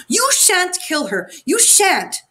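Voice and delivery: put-on high, shrill-free childish yell, pitched well up; a spoilt girl yelling her protest. A narrator's reading. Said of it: "she yelled".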